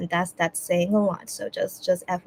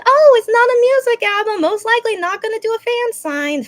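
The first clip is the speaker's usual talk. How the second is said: high, louder mock-casual thought voice; imitating her own assumption; 13 semitones higher, 7 dB louder than usual. Vlogger to fans, recalling what she assumed, high mock-casual. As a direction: replay the confident guess, lightly mocking herself. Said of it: "I was thinking".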